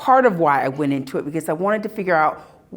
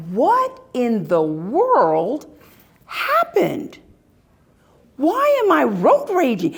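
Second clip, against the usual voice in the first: baffled at herself; 6 semitones higher than usual. Retold or imitated. Puzzled by her own rage.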